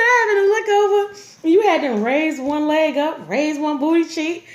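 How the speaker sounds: put-on boyfriend teasing. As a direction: rub it in playfully. Voicing boyfriend's teasing account.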